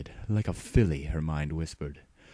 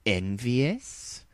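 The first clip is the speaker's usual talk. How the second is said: sing-song tease; 6 semitones higher than usual. A narrator's reading. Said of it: "Rainbow Dash sang".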